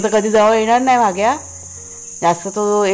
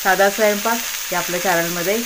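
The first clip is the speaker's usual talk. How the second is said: channel intro. Acted. Host greets viewers to her cooking channel, presenter style.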